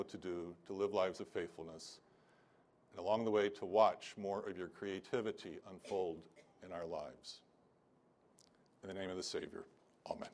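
heartfelt benediction to the church; closing prayer. Real, not acted. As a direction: pray over the congregation. Pastor leads closing prayer.

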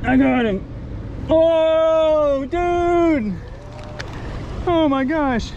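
high, loud cry of victory; triumphant. Real, not acted. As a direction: yell it as the fish comes in. Fish finally landed.

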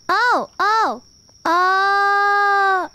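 a loud startled cry; real startled cry. Real, not acted. Streamer startled in the dark bathhouse cries out loud. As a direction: jolt with fright.

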